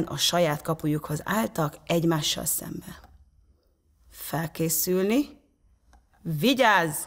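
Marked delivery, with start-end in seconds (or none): 4.1-7.0 yelled start command. A narrator's reading. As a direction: call the countdown out, word by word. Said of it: "üvöltötték".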